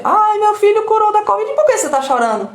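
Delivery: exaggerated moaning lament; whiny mock lament. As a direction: overplay the drama of a perpetual victim. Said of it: "o filho curou da covid".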